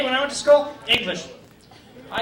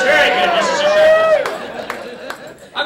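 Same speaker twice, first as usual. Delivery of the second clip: much higher, slow, stretched praise; high mock praise; 8 semitones higher, 8 dB louder, much slower than usual. Teacher gushing over his class, high and stretched.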